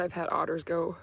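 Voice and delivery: ordinary delivery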